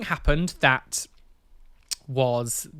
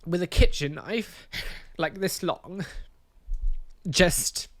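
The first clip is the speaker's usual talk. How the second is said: real tearful distress; 4 semitones higher than usual. Real, not acted. Recalling the attack witnessed as a child, tearful and halting. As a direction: voice breaking, long halting pauses.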